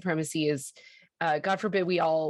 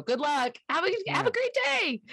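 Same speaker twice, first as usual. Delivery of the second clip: high, singsong fake cheer; mock-cheery send-off; 14 semitones higher than usual. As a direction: chirp it like an insincere sign-off, pitch soaring. Mock welcome speech to new adults.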